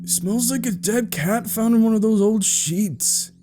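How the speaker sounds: put-on high-pitched kid complaint; grossed-out little brother; disgusted boy. A narrator's reading.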